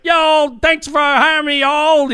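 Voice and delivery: fake Southern accent. Coach thanking Louisiana for hiring him, fake Southern accent. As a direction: lay the phony Southern charm on thick. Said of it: "acting like he's from the South".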